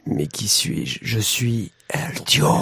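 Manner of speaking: low, slow otherworldly whisper; deep ghostly voice from the grave; voice from beyond the grave. Pretend. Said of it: "avec une voix d'outre-tombe".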